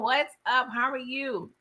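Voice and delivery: ordinary delivery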